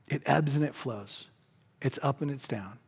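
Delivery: usual speaking voice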